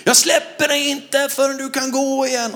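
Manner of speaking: high, loud final cry; retold all-out shout. Preacher's last shout to the woman, high and loud. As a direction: give it everything you have. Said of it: "jag skrek en gång till allt vad jag hade".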